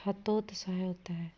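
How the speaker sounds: breathy aside with the answer; whispered correction. Shown as a demonstration. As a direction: whisper the right form. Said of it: "हतोत्साहित नहीं".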